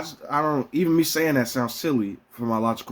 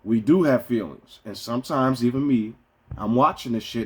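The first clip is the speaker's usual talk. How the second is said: normal talking voice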